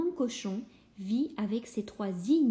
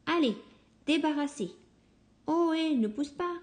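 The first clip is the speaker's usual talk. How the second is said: raised voice of a bustling mother pig; shooing them out; 6 semitones higher, faster than usual. Acted. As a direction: push the piglets out the door. Mother chasing piglets out.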